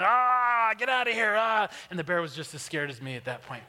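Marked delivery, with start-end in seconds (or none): 0.0-1.7 loud, high scare-off shout; yelling. Retold or imitated. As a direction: yell full out to frighten off a bear. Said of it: "I start yelling".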